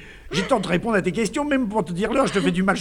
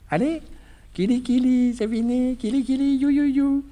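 higher, cooing nonsense; baby talk; 7 semitones higher than usual. Acted. Husband cheering up his sad wife, cooing baby talk. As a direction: coo like tickling a baby.

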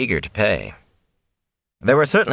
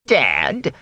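higher, affronted one-word question; indignant snap; 5 semitones higher than usual. A narrator's reading. Fane answers being thought dead, indignantly. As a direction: snap it back, offended. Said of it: "the peddler snapped indignantly".

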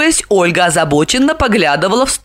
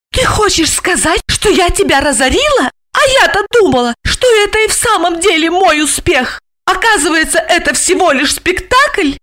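angry accusation; 11 semitones higher than usual. A narrator's reading. Wife angrily accusing husband.

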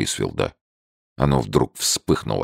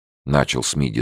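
usual speaking voice

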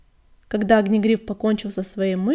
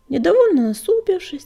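ordinary delivery

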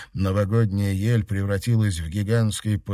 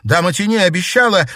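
high, louder insistence; insistent plea; 9 semitones higher, 7 dB louder, faster than usual. Acted. Girl defending herself to the grieving sister, insistent loud plea. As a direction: press the promise to be believed. Acted plea in heated quarrel.

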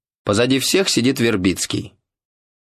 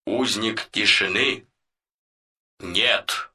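solemn voiceover; 4 dB quieter than usual. Retold or imitated. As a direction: intone like a pompous film narrator. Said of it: "Голос за кадром". (торжественный закадровый голос)

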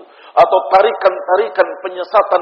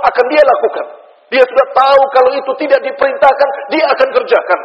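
raised, high-pitched sermon delivery with driving repetition; forceful preaching; 7 semitones higher, 4 dB louder than usual. Acted. Preacher warning the congregation against acting on emotion, forceful preaching. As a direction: press each warning home, high and insistent.

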